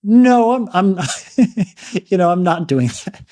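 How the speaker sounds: hammed-up protest, breaking into laughter; mock firm refusal. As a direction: play the guest finally putting his foot down. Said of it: "and he never once is like".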